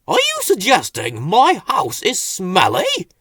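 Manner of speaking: put-on loud, indignant old voice; offended question. A narrator's reading. Slowcoach offended at Bill, indignant. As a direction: take offence. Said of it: "asked Slowcoach".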